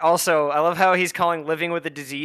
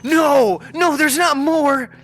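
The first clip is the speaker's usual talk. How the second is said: high, loud pleading, drawn out slowly; slow, loud, high-pitched denial; desperate shout; 8 semitones higher, 4 dB louder, slower than usual. Real, not acted. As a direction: beg that it isn't true, yelling. Denying that more is coming.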